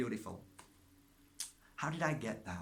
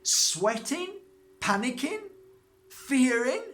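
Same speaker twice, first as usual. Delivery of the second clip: each word higher and louder, slow start; building list; 11 semitones higher, 9 dB louder, slower than usual. Real, not acted. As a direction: pile up the struggles to a peak. Listing struggles with rising force.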